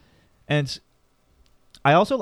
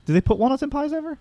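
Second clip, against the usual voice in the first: incredulous question; 12 semitones higher than usual. Real, not acted. Teasing a friend about walnuts in pie, incredulous. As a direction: pitch jumps much higher on a disbelieving question.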